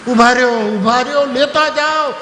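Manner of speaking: loud, high shout to stop them; calling after them. Retold or imitated. Husband shouts after running guests, loud and high.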